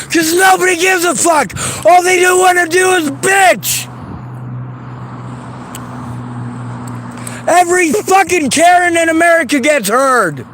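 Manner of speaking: yelling through sobs, voice cracking; crying angry shout. Real, not acted. Ranting at people who ignore victims, crying while shouting. Tearful rant about unheard victims.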